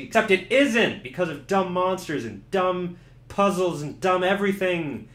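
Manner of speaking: ordinary delivery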